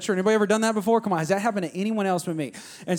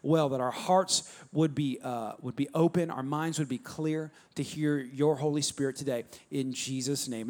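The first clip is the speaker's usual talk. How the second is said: soft, low opening prayer; 5 semitones lower, 5 dB quieter than usual. Real, not acted. Pastor opening the service, praying softly. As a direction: pray quietly over the congregation.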